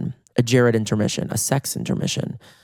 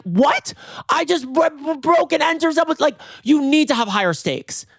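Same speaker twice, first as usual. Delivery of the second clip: high-pitched, stammering mock outrage; hammed-up incredulous protest; outraged disbelief; 13 semitones higher than usual. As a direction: sputter in squealing disbelief. Retold outrage at calm receptionist.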